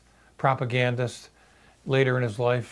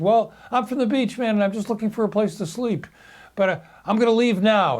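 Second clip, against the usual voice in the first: put-on spaced-out kid; 8 semitones higher than usual. Intruder explaining he wanted a place to sleep, dazed stoner voice. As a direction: voice the spaced-out beach kid, higher.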